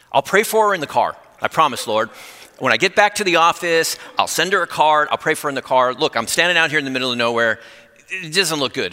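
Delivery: pleading excuses. Retold or imitated. Pastor bargaining with the Lord in the driveway, pleading.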